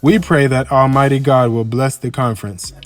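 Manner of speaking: ordinary talk, nothing marked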